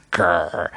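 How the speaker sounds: low throaty grr; playful growl. Shown as a demonstration. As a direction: growl it like a grumpy dog. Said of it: "I love a growl".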